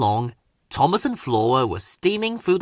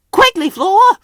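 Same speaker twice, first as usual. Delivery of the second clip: much higher, louder hurry call; urgent wheeze; 9 dB louder than usual. A narrator's reading. Thomas hurrying Flora away, urgent. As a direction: rush her off. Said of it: "Thomas wheezed".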